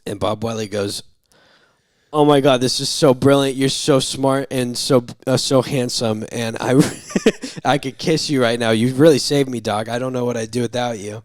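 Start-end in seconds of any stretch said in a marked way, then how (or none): 2.1-11.2 loud, higher, hammed-up gushing; overreacting gratitude. Patient thanks doctor for the book, imitated over-the-top gushing. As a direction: play a patient wildly overpraising his doctor. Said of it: "Very overreacting to the point".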